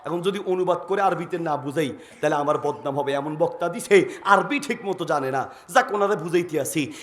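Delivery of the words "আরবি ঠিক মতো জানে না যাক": ordinary delivery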